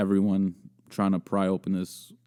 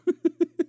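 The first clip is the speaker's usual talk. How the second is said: high, stuttering giggle; real giggling. Real, not acted. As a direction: crack up at your own story. Cracks up at own story.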